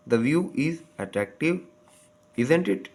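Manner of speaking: slow, isn't it? rising high; rising tag question. Shown as a demonstration. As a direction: end isn't it? on a high lift. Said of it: "Rising intonation".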